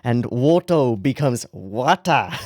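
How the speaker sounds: slow "Wata" with a broad Irish a; drawn-out Irish vowels on "Woto" and "Wata"; Irish accent. Shown as a demonstration. Vlogger spelling out the Irish accent, slowly. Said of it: "a strong Irish accent".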